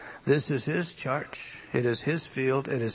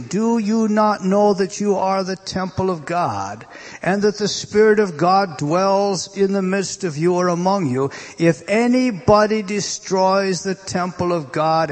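higher and louder, preaching the verse; preaching scripture; 6 semitones higher, 6 dB louder than usual. Real, not acted. Preacher thundering the verse at his congregation. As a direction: proclaim the scripture with force. Reads scripture at full force.

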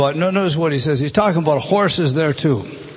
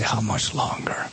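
softer, lower, aching question; hushed anguished plea; 7 semitones lower, 8 dB quieter than usual. Acted. The Son crying out to the Father, hushed and aching. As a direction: let it sink into a pained hush. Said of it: "the Son of God in our behalf cries out".

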